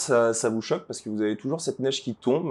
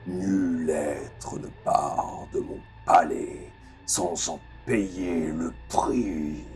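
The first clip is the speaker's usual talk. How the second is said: deep, slow, booming guardian voice; low and slow, echoing through the city; cavernous echoing voice; 4 semitones lower, 5 dB quieter, much slower than usual. Acted. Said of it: "une voix caverneuse qui a l'air de résonner".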